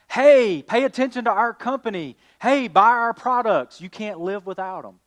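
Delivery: loud, high, pushy ad-man calls; mock shouting sales pitch; mock shouting ad voices. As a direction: hammer it like a pushy commercial. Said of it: "Their voices are shouting at us".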